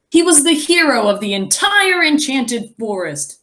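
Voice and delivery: ordinary delivery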